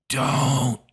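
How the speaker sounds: lightly creepy drawn-out "Don't."; mock Biden "don't". Host mocking Joe Biden's warning to Iran, creepy pretend whisper. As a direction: breathe the word like a creepy old man. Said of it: "creepily leaning into a microphone and whispering".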